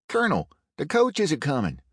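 quick call of warning. A narrator's reading. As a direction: call it out to the colonel, hurried. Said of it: "one of the men called".

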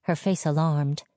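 ordinary delivery, nothing marked

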